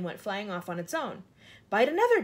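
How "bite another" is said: high, loud short command; louder, urgent storybook order; giving orders. A narrator's reading. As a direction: order it sharply, pitched well up. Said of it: "James ordered".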